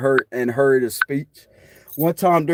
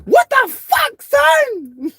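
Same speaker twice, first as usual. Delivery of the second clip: very high mock shriek, louder; imitating mama yelling; 5 dB louder, much slower than usual. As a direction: shriek at him like his angry mama. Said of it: "She said it just like that".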